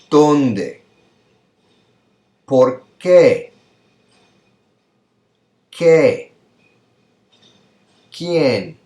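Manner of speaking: "Dónde", "Por qué", "Quién" each high, emphatic and falling; loud single words, long gaps for echoing; repeat-after-me modelling. Shown as a demonstration. Teacher drilling question words for students to repeat, high and emphatic. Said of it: "So, repita, por favor".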